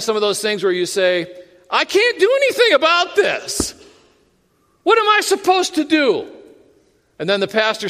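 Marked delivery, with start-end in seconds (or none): 1.7-6.3 high, loud, helpless protest; acted exasperated outcry. Preacher voicing a believer stuck in a situation, exasperated cry. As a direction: cry out in hammed-up frustration.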